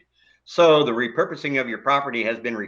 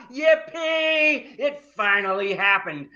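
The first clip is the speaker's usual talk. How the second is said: high shouted yippee, then still high; gloating over the neighbor's coming eviction, excited; 8 semitones higher than usual. Acted. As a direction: whoop with pure delight. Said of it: "can't hide his excitement".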